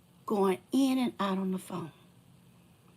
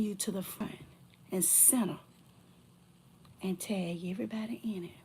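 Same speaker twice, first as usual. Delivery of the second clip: whispered threat; 2 semitones lower, 11 dB quieter than usual. Real, not acted.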